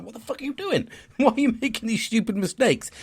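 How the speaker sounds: berating himself for stupid mistakes, laughing; exasperated self-scolding. Retold or imitated. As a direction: scold yourself in disbelief. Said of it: "I'm like".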